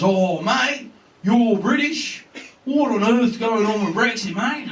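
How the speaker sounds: broad mock Australian, drawn out; Australian accent. Australian stranger questioning the British comedian, mimicked Australian accent. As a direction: put on a matey Australian accent.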